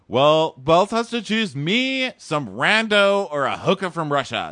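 high, loud, slow mock valley girl; mocking sassy girl. Mocking Ashley's snippy line, high, loud and slow. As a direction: drawl it out sarcastically, high and loud. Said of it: "She's like".

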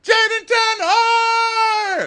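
very high, drawn-out mock scream; hammed-up excited shout. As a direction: shriek the name as a long, overblown climax. Chant climaxes in a shout.

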